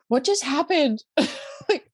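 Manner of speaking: a shocked what-just-happened; stunned surprise. Retold or imitated. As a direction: sound caught off guard, disbelieving. Stunned on arrival at hotel.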